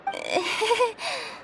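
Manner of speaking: a quiet, sheepish little laugh; soft embarrassed laugh. Acted. Teased about snagging her clothes, she gives a soft sheepish laugh. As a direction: laugh it off softly, caught out.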